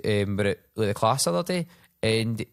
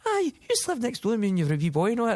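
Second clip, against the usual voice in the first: put-on fast, higher, cheery older man; quick, light voicing of a cheery examiner; examiner's voice; 11 semitones higher, faster than usual. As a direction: rattle it off like a chatty old neighbour. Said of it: "And he's like".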